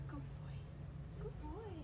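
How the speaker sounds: soft, high praise for a puppy. Real, not acted. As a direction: coo praise at the dog.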